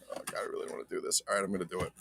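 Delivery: fast, whispered worry to himself; nervous whisper. Real, not acted. Collector whispering his nerves to himself before opening the box. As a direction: breathe it out anxiously, fast.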